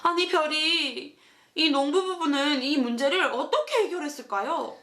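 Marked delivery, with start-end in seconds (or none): none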